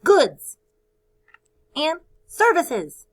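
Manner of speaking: Goods shouted out, services stretched slowly; big teacher call for kids to echo; loud choral call. Shown as a demonstration. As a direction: lead the class in a loud chant. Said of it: "Say it with me".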